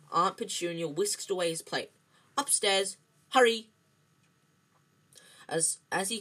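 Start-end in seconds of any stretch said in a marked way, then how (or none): 2.4-3.7 loud, higher command; sharp raised-voice shooing; barked order. A narrator's reading. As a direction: order him off urgently. Aunt orders him upstairs.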